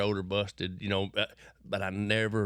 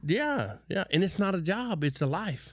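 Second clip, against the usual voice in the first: a pastor getting fired up about the father's business; fired-up preaching; 8 semitones higher than usual. Real, not acted. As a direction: build it like a sermon climax. Preaching with rising force.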